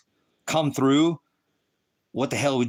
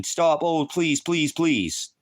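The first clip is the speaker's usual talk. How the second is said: mock pleading; 6 semitones higher, much slower than usual. Mocking people who plead for mercy.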